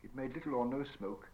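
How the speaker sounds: ordinary delivery, nothing marked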